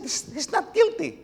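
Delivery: high, pleading Pilate quote; lightly voiced Pilate protesting; voicing Pilate. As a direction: raise the voice as the nervous governor.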